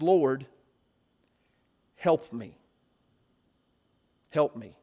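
low, subdued plea with long pauses; begging. Acted. Woman fallen before Jesus begs him, low humble plea. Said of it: "And she begs him".

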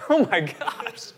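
shocked gasp. Retold or imitated. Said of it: "and I'm like".

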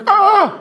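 sudden high startled yelp from the wife; startled shriek. Retold or imitated. Wife startled by husband's hands, high yelp. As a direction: squeal in surprise as hands land on you.

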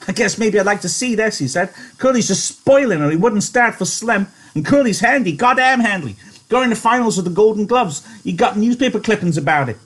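high, lightly voiced eager onlooker; lightly voiced Whit. A narrator's reading. Whit voiced in read story.

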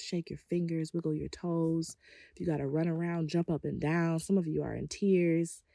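usual speaking voice

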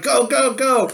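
high-pitched mock commando urging; urgent commando call. Paper commandos storming a grocery store, urgent high call.

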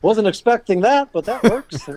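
high, pleasantly caught off guard; a client taken aback but pleased; surprised delight. Retold or imitated. Client reacting to a surprise catch, high and delighted.

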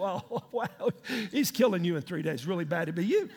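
low, deadpan mock sympathy; comically subdued retold condolence; awkward bad news. Joseph breaking bad news to baker.

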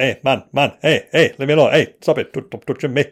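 mock-agitated Leeds accent; Martin's Leeds accent. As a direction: imitate a flatmate from Leeds objecting. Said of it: "I started picking up his accent".

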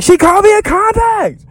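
mock yell. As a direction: squeal it like an offended diva. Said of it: "yelling at somebody".

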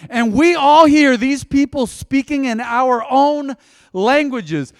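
loud, high, slow proclaiming preach; preaching at full force. Acted. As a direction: thunder the verse at the congregation. Preaching the verse.

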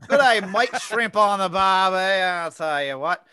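exaggerated Australian catchphrases, projected; Australian accent. Shown as a demonstration. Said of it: "Australian accent is the hardest one".

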